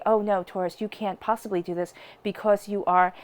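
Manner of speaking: usual speaking voice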